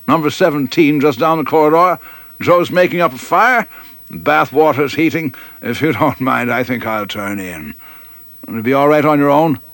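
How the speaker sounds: put-on a beery old flight lieutenant showing the way; hearty old officer.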